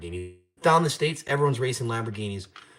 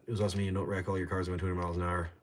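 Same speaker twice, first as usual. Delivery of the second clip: usual speaking voice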